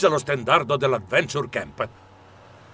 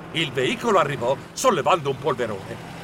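ordinary delivery, nothing marked

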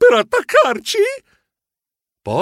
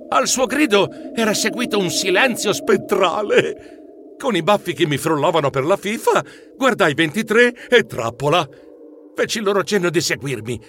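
usual speaking voice

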